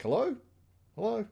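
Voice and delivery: mock-puzzled phone greeting, high and rising; high puzzled hello. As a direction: call into a silent phone, voice pitched well up. Buffy repeating into silent phone.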